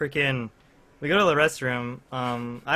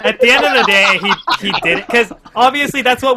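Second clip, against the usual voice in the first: laughing while telling; 9 semitones higher, 11 dB louder than usual. Real, not acted.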